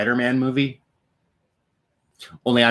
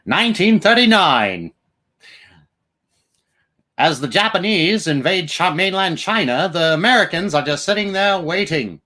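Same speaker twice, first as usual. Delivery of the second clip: put-on old-time newsreel announcer, higher, punchy; 6 semitones higher than usual. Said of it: "thirties, forties newsreel announcer type voices".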